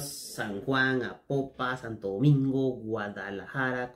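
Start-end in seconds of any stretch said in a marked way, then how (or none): none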